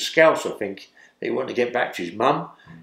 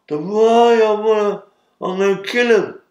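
imitating drugged inmate; 9 semitones higher, 6 dB louder than usual. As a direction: voice a drugged big man vowing to kill. Imitating drugged George threatening.

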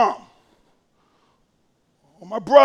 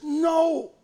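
God says no; 4 semitones higher, 3 dB quieter than usual. Pretend. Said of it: "So maybe God says".

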